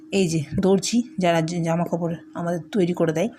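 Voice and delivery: ordinary talk, nothing marked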